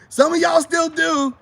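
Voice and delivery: loud, high and drawn-out accusation; insistent shout. Real, not acted. Man calling out viewers who won't admit it, shouting. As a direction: call them out at the top of your voice.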